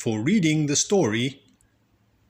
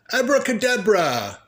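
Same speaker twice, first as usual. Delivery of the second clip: a bit louder, higher spell; magician's incantation; 10 semitones higher, 5 dB louder than usual. A narrator's reading. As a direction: cast it like a showy magician. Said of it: "Magic Daddy said".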